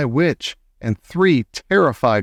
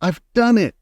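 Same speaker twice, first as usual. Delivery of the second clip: high, triumphant exclamation; cried out; 8 semitones higher, 3 dB louder than usual. A narrator's reading. Grandson reports success to grandmother, excited high cry. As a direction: burst out with the good news. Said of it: "I cried".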